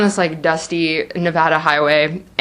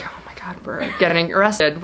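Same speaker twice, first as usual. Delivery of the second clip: whisper; 4 semitones lower, 6 dB quieter than usual. Retold or imitated. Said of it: "I was just like".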